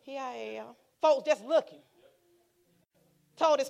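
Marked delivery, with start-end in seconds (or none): 0.0-0.8 soft, understated imitation of the minister; imitating the man's talk. Visiting man announces himself off the plane, soft imitation. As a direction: drop it softly, as he would. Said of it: "That's how he talked".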